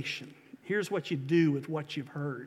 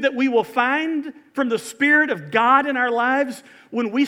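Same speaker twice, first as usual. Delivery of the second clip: raised, high, driving sermon delivery; full-force preaching; 7 semitones higher, 9 dB louder than usual. Acted. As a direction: preach it loud, pressing every phrase home.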